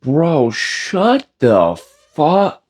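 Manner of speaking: mock outrage. Lifter mocks a tiny meal plan with slow fake outrage. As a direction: pretend to be shocked, slowly. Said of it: "I'm just kidding".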